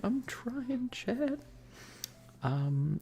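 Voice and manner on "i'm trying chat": high self-conscious laugh. Real, not acted. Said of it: "this actual A S M R thing".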